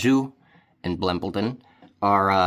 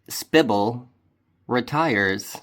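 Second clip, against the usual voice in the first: normal talking voice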